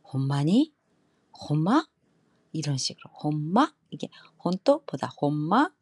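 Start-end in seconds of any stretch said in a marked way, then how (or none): 0.0-1.8 Kansai 혼마니?, louder; Kansai accent. Shown as a demonstration. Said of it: "칸사이 쪽은". 3.2-3.7 Kansai 혼마니?, louder; Kansai accent. Shown as a demonstration. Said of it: "완전히 칸사이 쪽 말투예요".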